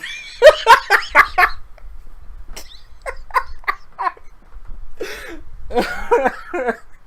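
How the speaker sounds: silent wheezing laughter; helpless breathless laugh; long wheezing laugh. Real, not acted. Breaks up laughing.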